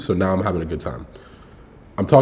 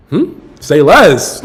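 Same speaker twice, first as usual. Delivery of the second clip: eager agreement; 8 semitones higher, 9 dB louder, much slower than usual. Retold or imitated. Eager reply to nieces' idea.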